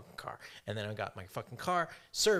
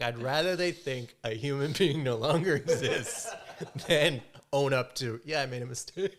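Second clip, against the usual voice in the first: louder, a put-on voice; mock laughing excuse; 3 semitones higher, 6 dB louder than usual. Explaining old thinking to the friend, mock voice, laughing. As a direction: laugh through the ridiculous excuse. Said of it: "I would be like".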